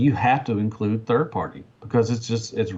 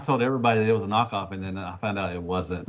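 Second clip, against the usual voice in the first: usual speaking voice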